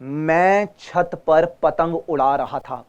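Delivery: slow, each syllable of "मैं छत पर पतंग उड़ा रहा था" crisp; slow clear reading. Shown as a demonstration. Teacher modelling the correct slow, clear reading. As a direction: read it slowly syllable by syllable. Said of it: "नहीं".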